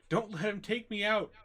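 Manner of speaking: high, loud cry for help; pleading yell. Acted. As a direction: yell desperately to the others in the room. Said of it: "keeps yelling".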